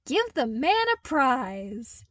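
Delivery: loud, high mock-cheerful shout; hammed-up celebration, not meant; false cheer. A narrator's reading. Luke mocking Zev with a loud fake cheer. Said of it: "in a show of false cheer".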